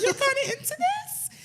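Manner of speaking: high, disbelieving rise; incredulous question. Retold or imitated. He asks the Uber driver, high and incredulous. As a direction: ask it in stunned disbelief.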